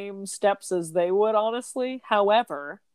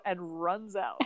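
ordinary talk

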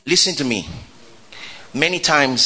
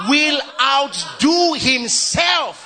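slow preached reading; 9 semitones higher, much slower than usual. Acted. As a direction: declare it slowly to the church. Leading the church in reading.

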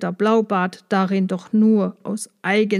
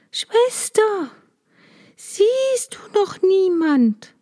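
much higher, pleading terrified wife; frightened calling; 9 semitones higher, slower than usual. A narrator's reading. Wife calling to her sister on the tower, high and terrified. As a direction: call up to the tower in desperate fear. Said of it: "Und dazwischen rief sie".

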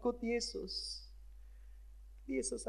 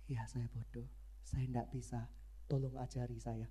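humble soft reply; 9 semitones lower, 8 dB quieter than usual. Retold or imitated.

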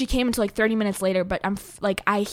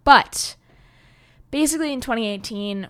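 ordinary talk, nothing marked

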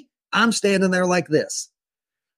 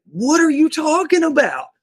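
baffled; 10 semitones higher, 4 dB louder than usual. Retold or imitated. Baffled reply to officer.

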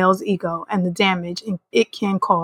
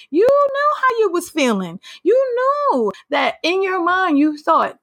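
pitched way up, scolding in disbelief; exasperated high scold; 10 semitones higher, slower than usual. Real, not acted. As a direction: call her out, voice jumping high. Scolding Whitley, rising voice.

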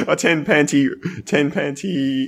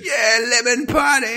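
cheery high party call; party shout; 10 semitones higher than usual. Pretend. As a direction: yell it like a goofy guest. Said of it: "Kendo Kimbo walks up and goes".

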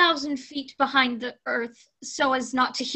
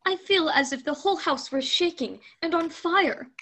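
terrified woman; 6 semitones higher, 5 dB louder than usual. Acted. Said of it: "said the woman".

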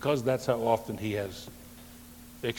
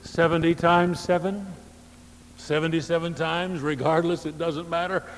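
louder and higher, hammering the numbers; emphatic preaching; 6 semitones higher, 5 dB louder than usual. Real, not acted. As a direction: proclaim it to the whole church. Preacher exclaims the count.

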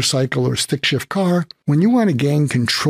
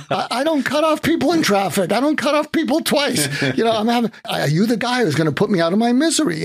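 much higher, half laughing protest; frantic laughing plea; 10 semitones higher than usual. Retold or imitated. Frantic driver explaining himself to the trucker, high and laughing. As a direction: babble it out, laughing and desperate.

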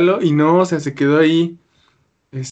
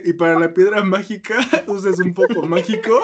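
higher voice breaking into laughter; laughing; 5 semitones higher than usual. Real, not acted. Joking with friends about the magic stone, laughing. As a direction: laugh through the joke.

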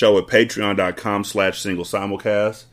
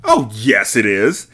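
louder insistence; shouted; 5 dB louder, slower than usual. Acted. Headmistress overriding Miss Honey, louder. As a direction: shout her down. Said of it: "shouted Miss Trunchbull".

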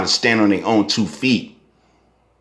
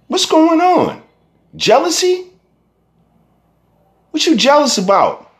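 incredulous shout. Real, not acted. Scolding jealous young rappers, high incredulous shout. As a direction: shout the questions up high in disbelief.

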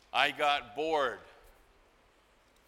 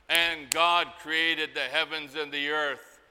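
solemn scripture reading; 5 dB louder than usual. Acted. Quoting Genesis aloud.

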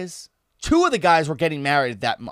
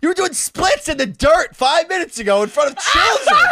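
high, loud mock-indignant shout; outraged retort; 12 semitones higher, 5 dB louder than usual. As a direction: yell the accusation in hammed-up outrage. Shouting back in retold quarrel.

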